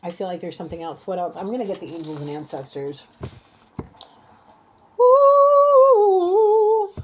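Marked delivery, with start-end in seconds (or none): 5.0-6.9 long, high, sung-out whoop; loud held "ooh". Real, not acted. Reader getting the angels and ancestors, loud held "ooh". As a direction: hold a loud high note, then let it sag.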